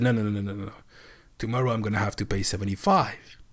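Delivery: ordinary talk, nothing marked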